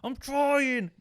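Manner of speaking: high, drawn-out frantic protest; retold exasperated yell. Player answering nagging partner mid-fight, exasperated high yell. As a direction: yell back in mock desperation.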